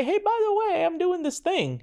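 high, light, casual offer voice; imitating himself making a cheerful offer; breezy mock pitch. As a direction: toss it off like a friendly sales aside. Imitating his own casual offer to buyers.

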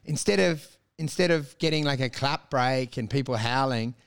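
normal talking voice

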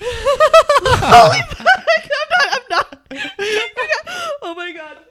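laughing, high and loud; shrieking with laughter; excited laughing shout. Real, not acted. First hit of poppers.

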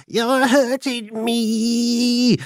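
high, slow, eerie voice from the stove; eerie goblin voice. Retold or imitated. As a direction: drag it out like a ghostly goblin in the wall. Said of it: "she heard a voice say".

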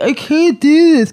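high, slow, strained whine; whining that you can't do it; frustrated whine. Retold or imitated. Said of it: "I was just like".

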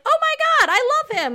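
high, loud gushing squeal; excited fangirl. Retold or imitated. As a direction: gush like a starstruck fan. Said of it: "I was like".

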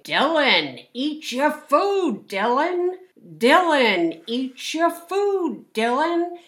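high, singsong mock of a nagging mother, slow and repetitive; nagging mom. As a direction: drone it like a tired mom repeating herself for the tenth time. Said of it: "she just kept saying".

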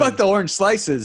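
high joking coach yell; mock coach shout. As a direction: bark it like a silly coach. Riffing as pervy coach.

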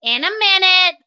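loud, high called reply; calling out again. Real, not acted. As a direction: shout it back to the next room. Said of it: "Bryn asked me for something".

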